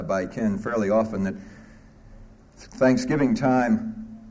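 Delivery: ordinary talk, nothing marked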